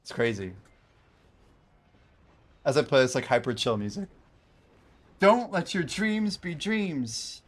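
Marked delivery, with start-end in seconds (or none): 5.2-7.4 motivational speaker. Retold or imitated. Quoting a motivational meme.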